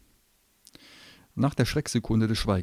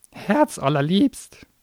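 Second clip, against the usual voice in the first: slow, high sneer; mocking; 10 semitones higher, 8 dB louder, much slower than usual. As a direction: drag the word out in scorn. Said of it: "spottete der Kleine".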